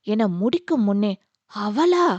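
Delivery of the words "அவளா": high, loud startled cut-in; sharp interruption. Acted. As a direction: Manjula cuts her son off in shock. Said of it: "Manjula இடைமறித்தார்". (அதிர்ச்சியுடன் இடைமறிப்பு)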